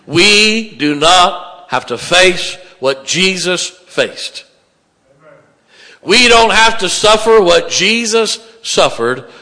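loud, slow, surging pulpit climax; fervent revival preaching; preaching at full force. Acted. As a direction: drive the promise home to the crowd. Climax of the sermon.

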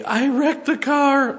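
high, slow, upset wife voice; wife on the phone. Retold or imitated. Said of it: "she called me".

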